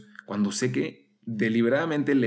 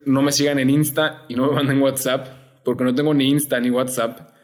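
louder, bright promo read, slowing for the web address; upbeat sign-off; 3 semitones higher, 6 dB louder than usual. Acted. Novice inviting viewers to find the group, a louder promo read.

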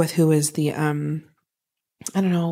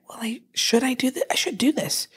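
whispering; 6 semitones higher, 3 dB quieter than usual. Retold or imitated. Acting out inner doubt.

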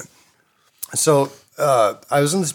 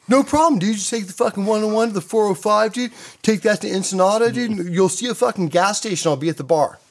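much higher, breezy surfer-dude voice; mock laid-back surfer giving directions; imitating Danny; 9 semitones higher than usual. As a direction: toss off directions like it's nothing. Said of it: "this is what Danny said".

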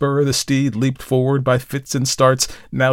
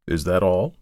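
radical's question; 4 semitones lower than usual. A narrator's reading. Radical questions the storyteller after his tale, in a low voice. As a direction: lower character voice, a touch sceptical. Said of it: "asked the radical".

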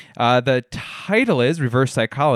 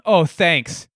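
mock yelling; 5 semitones higher, much slower than usual.

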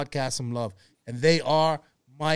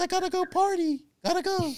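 mocking high voice. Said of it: "I thought you was like".

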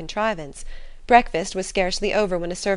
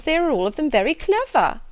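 higher, louder fussy mother; indignant mother; 9 semitones higher, 6 dB louder than usual. A narrator's reading. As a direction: insist proudly on her girls. Mrs Bennet defends her daughters.

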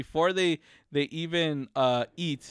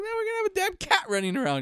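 fast grumble; 12 semitones higher, much faster than usual. Retold or imitated. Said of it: "He's like".

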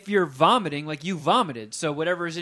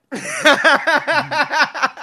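loud laugh; 11 semitones higher, 11 dB louder than usual. Real, not acted. Laugh after Puminati line.

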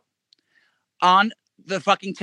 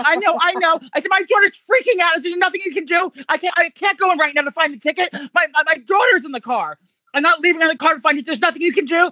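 frantic fake mom; 11 semitones higher than usual. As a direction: play the stressed mom to fool the attendant. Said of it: "I'm still in the character".

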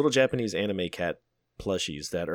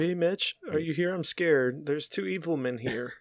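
higher, hammed-up frightened voice; mock scared; 8 semitones higher than usual. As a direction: play it like a scared kid hiding. Mock scared plea to friends.

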